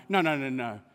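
normal talking voice

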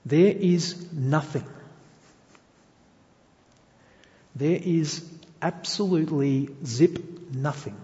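slow, deliberate declaration; measured emphasis, no rise in volume; emphatic and clear. Real, not acted. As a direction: spell it out word by word, with weight. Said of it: "Let's say this loud and clear".

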